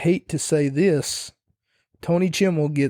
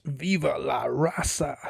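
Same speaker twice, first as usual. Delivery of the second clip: imitating entrance; 5 dB quieter than usual. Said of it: "the next thing that you hear is".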